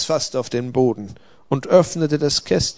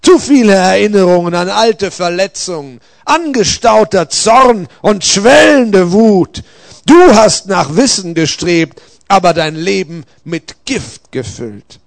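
loud, raised rebuke; stern messenger passing judgement aloud; reproving Gabriel, stern; 6 semitones higher, 11 dB louder than usual. A narrator's reading. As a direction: deliver a forceful indictment, leaning on key words.

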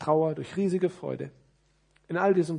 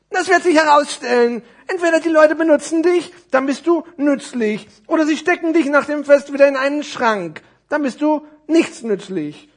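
high, loud lecturing character; high puppet voice, laying out the verdict; know-it-all necktie; 12 semitones higher, 13 dB louder than usual. Acted. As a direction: explain it emphatically as a storybook figure. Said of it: "sagte die Krawatte".

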